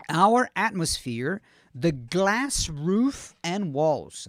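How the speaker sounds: very slow, each word drawn out; slow model reading. Shown as a demonstration. Teacher returning to the video's line for students, very slowly. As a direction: stretch the words out for learners. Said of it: "Vou devagarzinho aqui".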